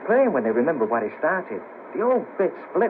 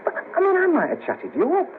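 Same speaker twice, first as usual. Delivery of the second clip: high, stumbling protest; pitched right up, thrown off balance; flustered astonishment. Acted. Realises he nearly flirted with her.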